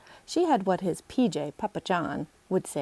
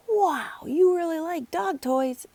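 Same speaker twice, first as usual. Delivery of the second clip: higher, slow amazed wow; in awe; 9 semitones higher, 4 dB louder, slower than usual. Acted. Girl amazed by the mayor's yard full of dog toys, awed. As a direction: marvel at the pile of toys. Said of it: "Emily said in awe".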